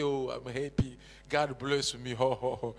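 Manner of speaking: mock the comfortable churchgoer, self-satisfied; smug mock contentment. Preacher mocking a complacent believer, smug and lazy.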